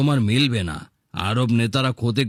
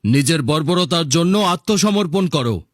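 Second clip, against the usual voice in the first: higher, louder, fierce demands; defiant demand; 6 semitones higher, 3 dB louder than usual. Acted. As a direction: hurl the demands at the tyrant. Defiant man confronts the leader. (উঁচু গলায় দৃঢ় প্রতিবাদ)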